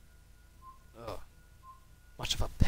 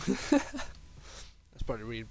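yawning; much slower than usual. Real, not acted. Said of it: "It seems for me to yawn there".

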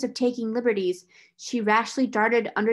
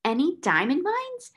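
higher startled exclamation; exclaiming; 7 semitones higher than usual. A narrator's reading. Headmistress exclaiming to the solicitor, higher and startled. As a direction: throw the question out in dismay. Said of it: "ejaculated Miss Minchin".

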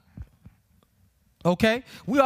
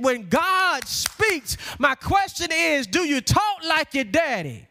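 drawn-out, emphatic words with pauses, rising high; impassioned preaching; 4 semitones higher, slower than usual. Acted. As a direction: build to a ringing preacher's climax. Preaches, building to a climax.

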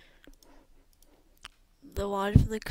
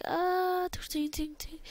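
high cry, then tinny clinks; imitated battle; 8 semitones higher, 4 dB quieter than usual. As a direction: voice a charge and clashing weapons. Imitates invasion cries and clashing.

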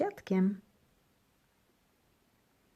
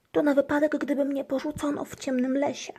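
fretful mutter; 9 semitones higher, 4 dB louder than usual. A narrator's reading.

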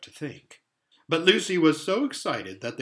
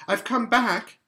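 excited; 9 semitones higher, 5 dB louder than usual. A narrator's reading. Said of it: "she repeated".

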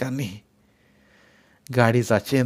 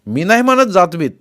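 louder, higher, proudly defiant; proud retort; 6 semitones higher, 6 dB louder than usual. A narrator's reading. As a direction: stand tall and answer with self-respect. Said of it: "मी स्वाभिमानानं म्हणालो". (स्वाभिमानानं, ठामपणे)

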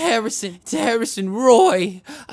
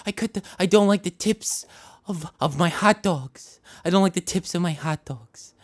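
ordinary delivery

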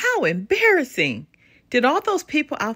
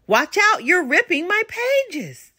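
ordinary delivery

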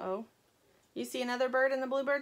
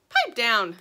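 snappy pipe down; 9 dB louder than usual. Acted. Sugar snapping.

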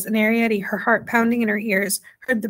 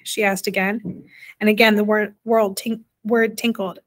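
plain reading voice

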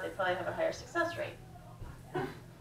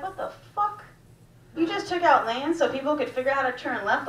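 incredulous outburst; 6 semitones higher, 4 dB louder than usual. Retold or imitated. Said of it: "I was like".

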